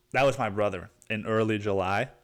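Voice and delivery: normal talking voice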